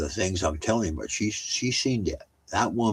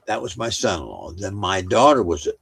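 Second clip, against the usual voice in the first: ordinary talk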